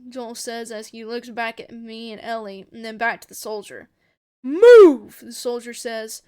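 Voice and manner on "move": very loud, raised one-word command; shouted order. A narrator's reading. Soldier ordering Joel aside, loud shout. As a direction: shout him out of the way.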